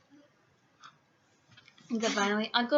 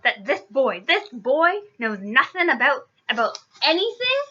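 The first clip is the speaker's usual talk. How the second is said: loud, slow outrage climbing high; drawn-out indignant challenge; angry disbelief; 4 semitones higher, 7 dB louder, slower than usual. A narrator's reading. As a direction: build to an incredulous high finish. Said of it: "he growled at the Dursley".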